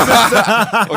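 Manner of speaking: high burst of laughter; loud laugh. Real, not acted. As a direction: cracks up loudly.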